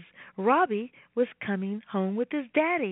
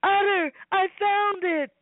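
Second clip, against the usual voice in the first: high, loud triumphant call; excited shout; 7 semitones higher, 4 dB louder than usual. Acted. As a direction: shout it out, thrilled. Robbie finds rod.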